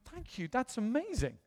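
high, soft, wonder-struck gratitude; amazed thanks. Retold or imitated. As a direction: re-enact thanks in a small high voice.

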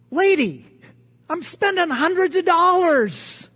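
indignant retort, played up; exasperated. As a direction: protest to an unhelpful clerk, acted-out exasperation. Acts out his exasperation at attendant.

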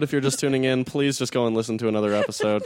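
usual speaking voice

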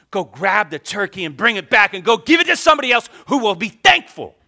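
the anger spills out in a rush; rising anger. Retold or imitated. Pastor reliving his urge to take the turkey back, furious.

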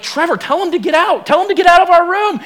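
imitated panicky pleading, pitched high; wife freaking out. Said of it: "freaking out".

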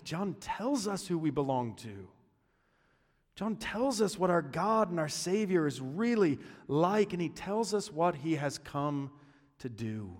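normal talking voice

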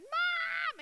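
put-on high, loud child's call down the street; child calling mum. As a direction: yell for your mother across a crowd. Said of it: "then you hear the second".

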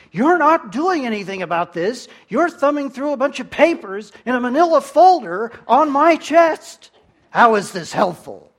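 loud, high, frantic complaint; panicking patient snapping at the doctor; panicked outburst. Retold or imitated. Patient in panic yells at his doctor, loud and high. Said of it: "prompts to panic".

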